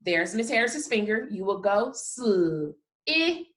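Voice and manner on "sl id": sl, i, d with gaps between; sound by sound. Shown as a demonstration. Teacher showing what children will say, sound by sound. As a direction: break slid into separate sounds. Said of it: "give me the sounds that you hear".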